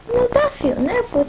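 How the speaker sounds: ordinary talk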